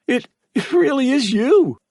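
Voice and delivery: put-on high, breathless disbelieving boy; awed. A narrator's reading. Son sees his mother appear, awed. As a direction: wonder at the sight of her.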